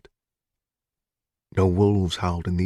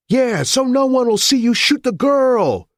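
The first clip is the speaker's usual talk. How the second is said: much higher and louder frantic yell; hysterical yell; 5 dB louder than usual. A narrator's reading. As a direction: scream it at the cops. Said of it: "a hysterical voice yelled".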